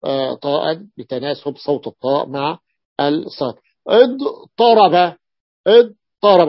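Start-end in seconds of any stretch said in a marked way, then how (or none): 3.9-6.5 اضطرب said slowly and louder, with a heavy emphatic ṭ after ḍ; اضطرب idtaraba, slow and emphatic. Shown as a demonstration. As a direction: stretch اضطرب and press on the ṭa. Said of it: "قلبت التاء أيضًا طاء".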